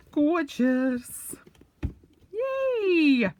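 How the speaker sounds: "Gorgeous" sung high and loud with delight; delighted squeal. Real, not acted. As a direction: squeal the word "Gorgeous" in glee.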